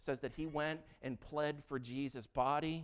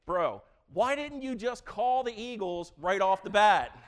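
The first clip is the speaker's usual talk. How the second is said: loud mock call-out to Tolkien; 4 semitones higher, 11 dB louder than usual. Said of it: "I would have been like".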